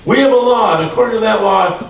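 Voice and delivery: lightly voiced crowd, pressing its case; angry mob. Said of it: "They became a mob".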